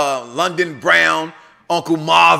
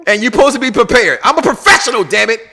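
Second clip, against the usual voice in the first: mock diva rant; 5 semitones higher, 5 dB louder than usual.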